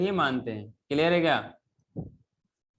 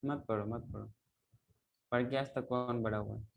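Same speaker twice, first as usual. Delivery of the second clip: sarcastic muttering; 7 semitones lower, 10 dB quieter than usual. Real, not acted. Teacher scolds silent class sarcastically.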